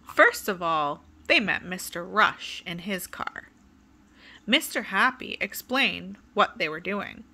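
put-on lively, sing-song read-aloud for kids; storybook reading. A narrator's reading. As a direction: tell it like bedtime story time. Reading a picture book to children.

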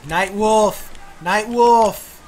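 calling out. Real, not acted. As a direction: call him over. Calling out to character.

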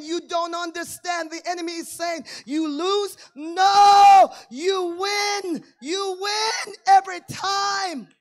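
shouting, high preacher climax; triumphant shouted sermon, slow on the last words; full-force preaching. Acted. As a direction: preach it out, building to a roar.

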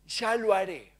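soft, offhand promise; a mild lazy "later"; careless brush-off. Retold or imitated. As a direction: shrug it off quietly. Believer brushes off God.

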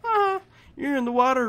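usual speaking voice

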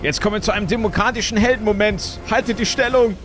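high, rousing war-movie hero; rally the troops like a film hero; heroic battle speech. Pretend.

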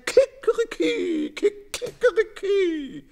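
high, loud crowing cock-a-doodle-doo; rooster crow. A narrator's reading. The rooster crowing at the bread bait, high and loud. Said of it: "fängt er auch schon an zu krähen".

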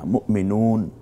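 মুমিনুন with the final vowel dropped, stopping on the n; মুমিনুন, final vowel silent; pausal form. Shown as a demonstration. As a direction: say মুমিনুন ending cleanly on n, no -a. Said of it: "মুমিনুনা হবে না".

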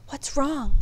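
usual speaking voice

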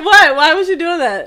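high, loud mock shock; loud outrage. Real, not acted. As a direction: shout it, playful.

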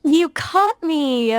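high, drawn-out playful shock; surprised. Real, not acted. AI voice caught coaching the user, surprised. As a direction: exclaim it high, stretching the words. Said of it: "ทำเสียงตกใจ".

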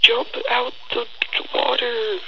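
squeaky high pleading; urging the game. Real, not acted. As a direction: beg the character to jump.

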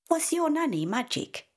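put-on high, curious little girl voice; wide-eyed child question. A narrator's reading. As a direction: ask in wonder like a small child. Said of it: "asks Isla".

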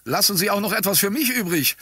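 high, forceful cry; fired-up calling out. A narrator's reading. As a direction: call out to the mob, spoiling for a fight. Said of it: "rief ich mit geballten Fäusten".

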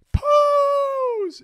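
very high, loud drawn-out squeal; screaming little kid. Retold or imitated. Kid that has everyone cracking up, screaming. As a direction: shriek it like an overexcited child. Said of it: "he runs off screaming".

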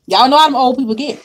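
normal talking voice

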